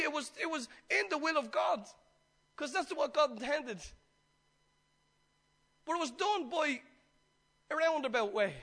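ordinary delivery

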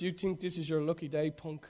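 low, soft gravel-free Dirty Harry menace; a lightly voiced tough cop, low and quiet; Clint Eastwood impression. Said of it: "I used to be able to do his accent".

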